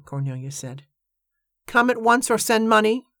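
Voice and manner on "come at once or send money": high-pitched recital with a rising end; quoting a telegram. A narrator's reading. Iris quotes the telegram back to Cornelia, high-pitched recital. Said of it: "Iris quoted".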